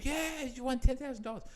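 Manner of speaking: high host voice; lightly hammed-up radio presenter; host announcing a prize. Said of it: "And you go".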